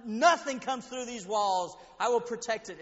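loud and slow vow; preaching at full force. Acted. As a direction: lean on every word of the promise.

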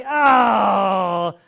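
loud, drawn-out "oh" of dismay; upset groan. Retold or imitated. Movie watcher groans on finding it's in Italian, upset. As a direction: wail a big disappointed "oh". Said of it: "like, upset".

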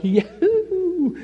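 high whooping yahoo with a laugh; laughing, jubilant yahoo; joyful whoop. Retold or imitated. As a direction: whoop with relief and joy. Father's joy at the news.

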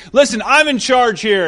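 asserting authority. Acted. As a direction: act out a man claiming he's in charge. Acted quote of movie boss.

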